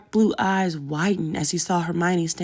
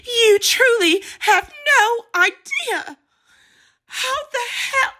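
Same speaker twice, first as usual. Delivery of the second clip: much higher, louder, slow raging cry; furious outburst; 6 dB louder than usual. A narrator's reading. Pansy rounds on Hermione in a raging outburst. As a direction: let the fury break loose.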